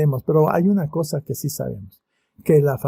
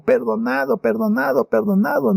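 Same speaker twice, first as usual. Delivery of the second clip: joyful repetition; 7 semitones higher than usual. Acted. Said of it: "No puedo ni imaginarlo".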